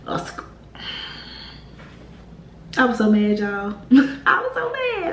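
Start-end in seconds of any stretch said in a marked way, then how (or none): 3.9-5.1 high laughing "I was so mad". Real, not acted. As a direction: laugh while saying it. Laughs retelling anger.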